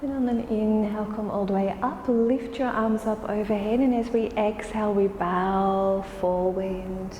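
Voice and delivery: normal talking voice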